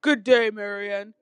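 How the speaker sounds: loud, slow, grand greeting; bellowing god. A narrator's reading. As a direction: bellow it out unhurriedly. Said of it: "bellowed Zeus".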